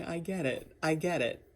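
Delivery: normal talking voice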